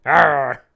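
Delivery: loud hammed-up arr; mock passionate roar. Manager urging his team on, mock passionate roar. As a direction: roar to show some passion. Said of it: "show some passion".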